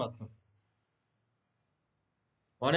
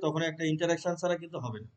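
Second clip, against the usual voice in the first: ordinary talk, nothing marked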